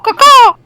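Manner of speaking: high, loud crow call; high-pitched mock squawk; imitated bird caw.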